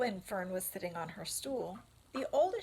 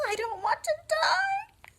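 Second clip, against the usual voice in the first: much higher, much louder crying scream; 9 dB louder than usual. Acted. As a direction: scream and sob it. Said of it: "screamed Wilbur".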